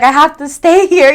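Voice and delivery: loud, high frustrated outburst; furious that she can't get out; angry loud declaration; trapped frustration. Retold or imitated. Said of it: "I was like".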